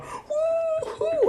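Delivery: drawn-out falsetto "woo-hoo"; airy, soaring cheer; high whooping shout. Acted. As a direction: whoop way up high without blasting it. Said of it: "jump high into the air and just shout".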